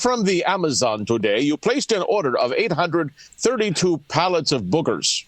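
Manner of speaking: mock Indian-accented phone caller. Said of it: "uh, the Hindi voice".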